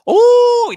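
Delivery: a loud, very high squeal of ooh; shrill ooh. Real, not acted. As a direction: let out a sharp, very high ooh. Reacting to a comment.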